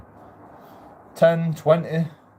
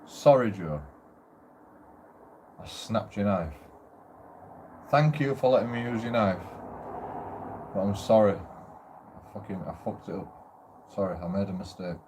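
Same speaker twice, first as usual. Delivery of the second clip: lower, softer mock apology, fading to almost nothing; imagined sheepish apology; 5 semitones lower, 6 dB quieter than usual. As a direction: play the guilty flatmate owning up, quietly. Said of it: "imagined flatmate apology".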